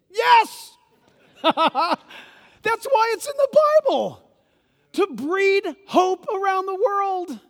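loud high cry, stretching out the words; exultant. Real, not acted. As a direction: laugh and proclaim it triumphantly. Preacher exults at the answer.

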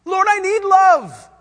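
a needy believer crying to God, loud plea; desperate plea. Acted. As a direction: plead out loud to the Lord. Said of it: "voicing a needy person".

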